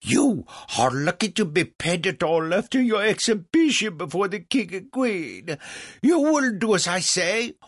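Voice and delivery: high, loud, pompous tirade; threatening French impresario. Acted. Legras threatens Mozart's Paris career, loud and angry. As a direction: lay down the threat furiously.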